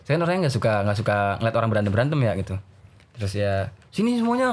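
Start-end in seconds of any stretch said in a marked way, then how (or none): 3.9-4.5 high-pitched calling out; teacher calling the group in; acted summons. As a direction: order everyone over, retold. Teacher summons fighting students.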